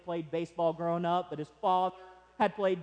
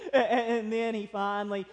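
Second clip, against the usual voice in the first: normal talking voice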